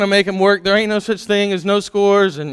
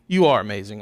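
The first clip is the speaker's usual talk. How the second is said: ordinary talk, nothing marked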